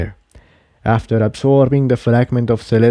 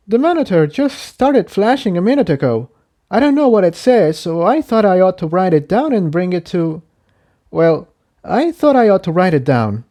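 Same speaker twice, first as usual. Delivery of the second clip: higher voice, a bit rushed and unsure; higher-voiced dragon, uncertain; Incendia, puzzled; 8 semitones higher than usual. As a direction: explain it, half thinking aloud. Incendia explains monitor.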